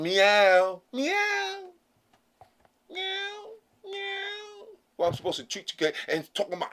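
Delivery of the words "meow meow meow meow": mock cat meow. Someone who woke up thinking they're a cat, mock meowing. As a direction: imitate a cat in a thin high voice. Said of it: "wake up one day going".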